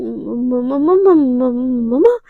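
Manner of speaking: caught-out stutter on mama; nervous stammer. Acted. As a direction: stammer the word like a guilty child. Caught daughter stammers to mother.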